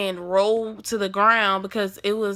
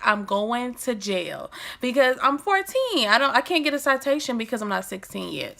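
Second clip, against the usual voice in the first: ordinary talk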